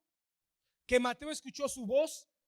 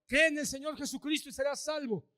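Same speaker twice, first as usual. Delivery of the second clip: ordinary delivery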